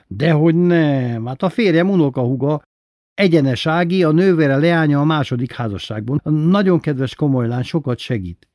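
high, loud, insistent praise; emphatic assurance. A narrator's reading. Aranka néni vouching for her husband's relative, emphatic assurance. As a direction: start high and loud, ease off by the end.

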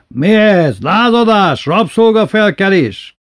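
high, loud indignant questions; incredulous bellowed challenge; outraged shouting. A narrator's reading. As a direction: demand it like an affronted tyrant. Outraged master faces slave revolt.

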